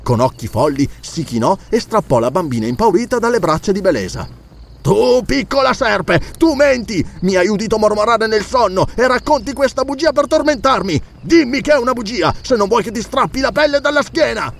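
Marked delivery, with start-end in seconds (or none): 4.8-14.5 high, strained furious accusation; enraged threat. A narrator's reading. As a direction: accuse and threaten the child in a rage. Enraged count threatens the child.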